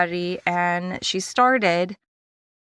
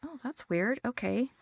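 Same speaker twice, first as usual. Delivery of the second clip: much softer, under-the-breath puzzlement; puzzled inner thought; 3 semitones higher, 11 dB quieter than usual. Retold or imitated. Noticing the assistant's empty hands, quietly puzzled. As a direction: think it to yourself, barely aloud.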